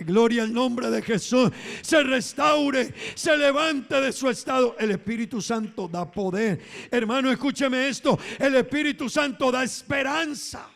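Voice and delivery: high, loud sermon proclamation; fervent preaching. Real, not acted. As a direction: proclaim it to the congregation with full force. Preaches at full force.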